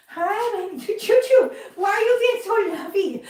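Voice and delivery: high, sing-song coo to a pet. Real, not acted. Talking to her cat.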